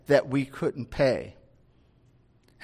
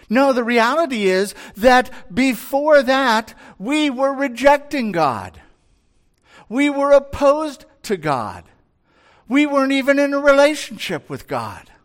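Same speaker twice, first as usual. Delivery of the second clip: higher, louder, driving sermon peak; preaching at full force; 7 semitones higher, 4 dB louder than usual. Acted. Preacher to congregation, rising full-force preaching. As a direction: build it up and hammer the point home.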